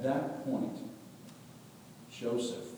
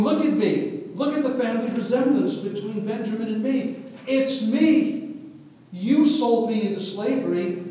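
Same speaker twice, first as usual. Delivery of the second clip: much higher, louder urgent plea; in their faces; 11 semitones higher, 9 dB louder than usual. Acted. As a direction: get right into their faces. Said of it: "Getting right into their faces and going".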